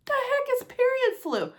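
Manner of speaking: high, loud baffled question; baffled squeak. Retold or imitated. Woman reading about period flu, high baffled squeak. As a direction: squeak it out in total confusion. Confused quote of herself reading.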